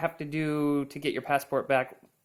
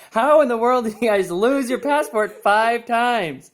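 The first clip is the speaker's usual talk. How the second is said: high, loud, drawn-out astonishment; incredulous; 10 semitones higher, 10 dB louder, slower than usual. Real, not acted. Interviewer asks how they lost the passport so often, incredulous. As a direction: exclaim it slowly in loud disbelief.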